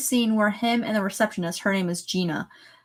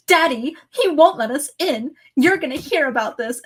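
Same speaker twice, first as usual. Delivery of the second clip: put-on whiny rich kid; 6 semitones higher, 4 dB louder than usual. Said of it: "they call their father and they go".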